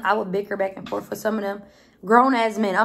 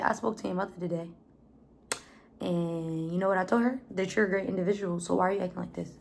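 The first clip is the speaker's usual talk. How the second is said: soft, low-key imitation of a stern talk; quiet serious talk; 2 semitones lower, 7 dB quieter than usual. Drill sergeant gently confronting trainee about mother, quietly. Retells sergeant's words that brought tears.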